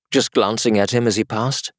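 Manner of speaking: ordinary delivery, nothing marked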